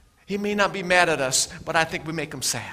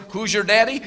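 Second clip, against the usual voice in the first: louder, rising question; a mock challenge from a bystander; bright challenge; 5 semitones higher, 6 dB louder than usual. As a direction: ask it boldly. Question asked of him later.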